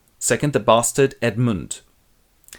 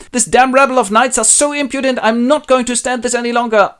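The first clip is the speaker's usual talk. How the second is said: higher, louder complaint; shrill angry daughter; 12 semitones higher, 7 dB louder than usual. Acted. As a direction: rant about the knights. Goneril complains about knights.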